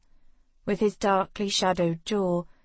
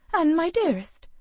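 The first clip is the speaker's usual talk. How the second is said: a mother calling her daughter's name pitched up with worry; lightly voiced worried mother, fond; anxious fond call; 9 semitones higher, 3 dB louder than usual. A narrator's reading.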